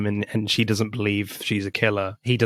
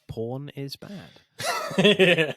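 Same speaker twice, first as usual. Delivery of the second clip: slow flat reading; 4 semitones higher, much slower than usual. Retold or imitated. Reading Holland's note aloud.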